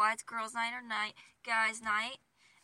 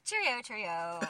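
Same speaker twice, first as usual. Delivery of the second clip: mock British accent. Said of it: "with your British ass".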